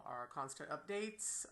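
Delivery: ordinary delivery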